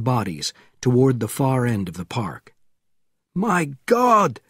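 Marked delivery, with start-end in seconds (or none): 3.3-4.4 alarmed cry, raised voice; shouting. A narrator's reading. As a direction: cry out in shock. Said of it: "shouted Panov".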